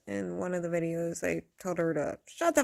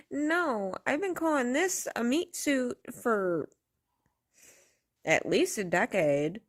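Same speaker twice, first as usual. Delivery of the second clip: ordinary talk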